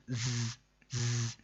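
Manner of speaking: ز as a sustained sharp zzz with no vowel; whistle-like buzz. Shown as a demonstration. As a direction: buzz ز through the teeth like a whistle. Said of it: "سیٹی کی طرح کی تیز آواز نکلتی ہے". (ز سیٹی جیسی تیز آواز میں)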